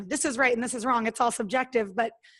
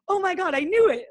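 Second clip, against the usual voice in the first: higher, louder thrilled inner voice; inner excitement; 6 semitones higher, 4 dB louder than usual. Retold or imitated. Telling the host how she reacted inside, higher and louder, thrilled. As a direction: let the private thrill burst out. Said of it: "And in my mind, I'm like".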